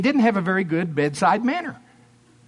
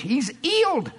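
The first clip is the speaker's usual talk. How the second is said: put-on higher-pitched English accent on a dropped H; 6 semitones higher than usual. Preacher mimicking the healer for laughs, English accent. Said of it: "They couldn't say H".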